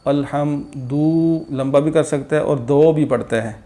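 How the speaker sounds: normal talking voice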